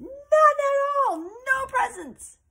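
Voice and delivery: high, slow, loud yell of despair. Acted. Girl yelling to her friend about no presents, loud slow wail. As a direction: wail it out like a frantic kid. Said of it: "She's yelling".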